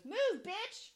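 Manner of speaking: put-on high, loud toddler outburst; mock toddler yell. Toddler yelling from the back seat, mock loud yell. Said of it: "literally so loud".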